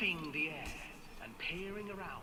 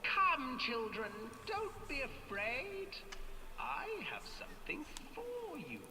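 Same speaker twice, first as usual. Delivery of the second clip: put-on high sugary old witch; sweet witch voice; 10 semitones higher than usual. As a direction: lure them in like a witch. Witch coaxing children.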